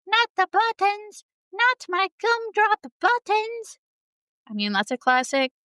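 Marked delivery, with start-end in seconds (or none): 0.1-3.8 much higher, squeaky cookie voice; panicked high Gingerbread Man begging. Retold or imitated. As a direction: plead in a tiny high cookie voice. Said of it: "the Gingerbread Man".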